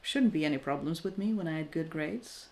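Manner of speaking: usual speaking voice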